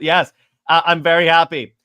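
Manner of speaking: normal talking voice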